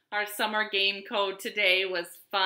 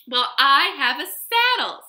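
loud, higher excited offer; overjoyed; 6 semitones higher, 10 dB louder than usual. A narrator's reading. Cowboy to his new horse, loud and excited. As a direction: speak up eagerly, big and bouncy.

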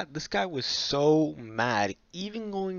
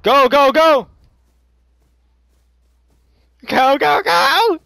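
high, loud frantic yelling; urgent shout; 12 semitones higher, 15 dB louder, slower than usual. Real, not acted. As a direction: yell at them to run. Escaping with loot.

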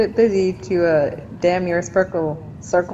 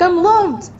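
high, loud burst of hopeful joy; mock excitement; 11 semitones higher, 5 dB louder than usual. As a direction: play up being thrilled to get a message. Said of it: "I got really excited".

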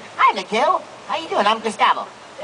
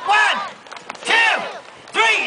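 calling out the count; 8 semitones higher, much slower than usual. Real, not acted. Counting aloud to lead crowd.

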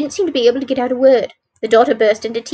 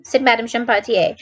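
usual speaking voice

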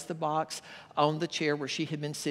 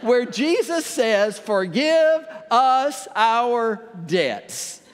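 higher and louder, preaching to the congregation; 4 semitones higher, 6 dB louder than usual. Acted. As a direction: lift into the sermon with full voice. Shifts from joke into sermon.

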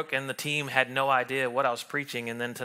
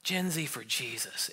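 hushed whisper; 3 semitones lower, 8 dB quieter than usual. Retold or imitated. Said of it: "they just started whispering".

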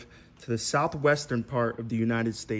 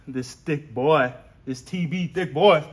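ordinary delivery

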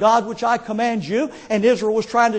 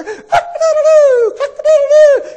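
very high, loud rooster imitation; rooster crow; 8 dB louder than usual. Preacher imitating a rooster heard over the phone, loud crowing. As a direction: crow it out shrilly, long falling last note. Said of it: "is that that rooster".

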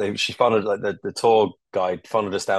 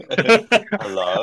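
imitating wary hello; 3 semitones higher, 5 dB louder than usual. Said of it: "like you're answering a phone".

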